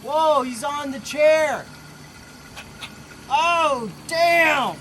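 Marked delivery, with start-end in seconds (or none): none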